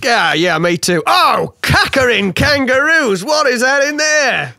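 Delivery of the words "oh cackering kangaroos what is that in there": higher, shocked cries about the fire; panicked boss demanding answers; alarmed outburst. Acted. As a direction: jump up in pitch, startled and accusing.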